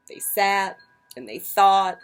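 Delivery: ordinary delivery, nothing marked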